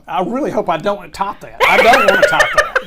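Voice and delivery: loud high laughing disbelief. Real, not acted. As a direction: laugh while you swear it off. Laughing about the story.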